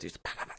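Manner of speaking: breathy "pa, pa, pa" like quick shots; soft imitated gunfire; whispered gunshot noises. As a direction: mimic rapid fire under your breath. Imitating rapid gun shots.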